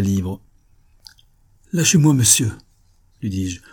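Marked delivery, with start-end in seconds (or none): 1.7-2.5 raised, high, firm order; sharp protest to an attacker; indignant command. Acted. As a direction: order him to let go, loudly. Seized woman orders him off.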